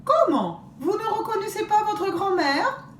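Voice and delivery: fake grandmother. Wolf faking grandmother to the girls, mock offended. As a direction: pretend to be hurt as granny.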